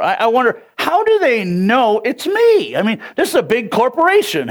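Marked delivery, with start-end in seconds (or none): 0.8-2.7 high, drawn-out puzzled question; exaggerated bewilderment; mock bafflement. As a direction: wonder aloud, slowly and high. Acting out his own puzzlement.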